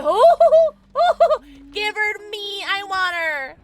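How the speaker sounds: high, loud, drawn-out whooping; excited squealing. Real, not acted. Player hears the Huntress and wants her, excited squealing.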